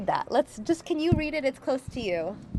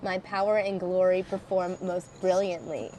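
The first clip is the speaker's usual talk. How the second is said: mock biblical speech; 6 dB louder than usual. Second reader, scripture.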